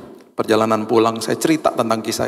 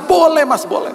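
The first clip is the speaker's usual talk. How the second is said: eager driver; 4 dB louder than usual. Retold or imitated.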